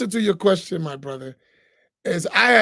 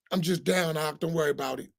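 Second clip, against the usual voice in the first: mock excuse; 5 semitones lower, 6 dB quieter than usual. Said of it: "That's what I hear all the time".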